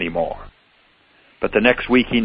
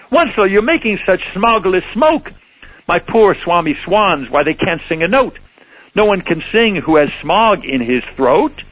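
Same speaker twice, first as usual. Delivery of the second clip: higher croaking Lorax voice; croaky Lorax; 6 semitones higher than usual. Acted. Lorax complaining about smog to Onceler, croaky. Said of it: "he cried with a cruffulous croak".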